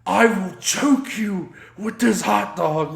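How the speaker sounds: John threatening the driver, hammed up; mock threat. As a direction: lean into the silly menace. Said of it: "And he goes".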